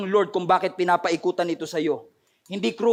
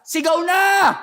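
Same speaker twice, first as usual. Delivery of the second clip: high-pitched shouted order; sudden raised-voice battle cry; commanding shout; 9 semitones higher, 5 dB louder, slower than usual. Acted. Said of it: "pag sinabi ng Lord na".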